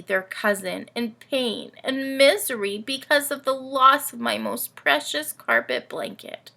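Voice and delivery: put-on lively read-aloud for kids, camel telling its tale; storybook reading. A narrator's reading. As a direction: tell it like a bedtime picture book. Camel narrator reads story.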